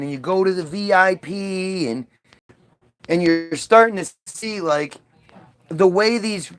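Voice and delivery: normal talking voice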